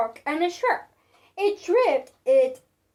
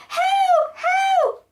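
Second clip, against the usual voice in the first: much higher, louder cries; cried help; 14 semitones higher, 4 dB louder than usual. Acted. Witch chased by the fire-breathing dragon, panicked cries. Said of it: "cried the witch".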